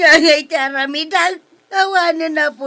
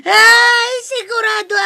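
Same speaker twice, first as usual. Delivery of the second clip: wailing, complaining through tears; crying; 7 semitones higher, 5 dB louder than usual. Acted. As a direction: sob out the loss, higher on the first cry. Victim wails about stolen wallet. (agsangsangit nga agreklamo)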